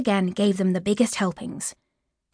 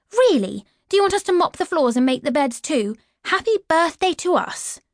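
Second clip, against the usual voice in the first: higher, bitter sarcasm; a touch of angry sarcasm; sarcastic outburst; 7 semitones higher than usual. Acted. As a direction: snap back at the stepmother. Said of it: "that was when I snapped".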